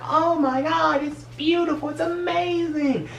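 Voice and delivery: very high, breathless wonder, slowing at the end; old lady spinning under the sky, gushing in high wonder; awestruck. Retold or imitated. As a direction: gush like seeing heaven. Spinning round, awed by the sky.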